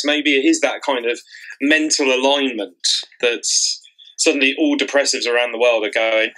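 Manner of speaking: ordinary talk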